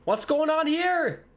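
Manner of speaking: truly lost at what the ref is doing; baffled. Real, not acted. Confusing referee decision.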